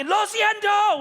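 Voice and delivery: high, loud Spanish apology; hammed-up yelled apology; mock shouted apology. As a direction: yell sorry back at the officer. Shouted apology back to cop.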